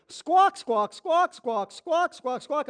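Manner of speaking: squawks fading from loud and high to near plain; mimicking complainers, loud squawks that trail off; mock squawking. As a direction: squawk like a complaining bird, dying away.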